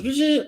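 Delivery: high buzzing imitation of a motorized mask opening; imitating a mask opening with a buzz. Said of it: "the mask goes".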